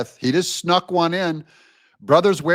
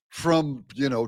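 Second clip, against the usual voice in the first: normal talking voice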